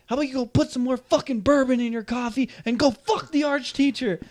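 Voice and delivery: snapped insult. Retold or imitated. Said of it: "And so I snapped".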